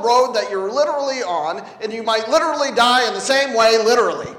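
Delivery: loud, insistent preacher's push; emphatic insistence. Real, not acted. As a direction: hammer "literal" and "literally" each time. Hammering the word literally.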